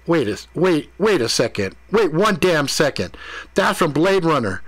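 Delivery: mildly indignant. Acted. Ethan realizes he is being pranked, mildly indignant interruption. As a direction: cut in with a touch of protest.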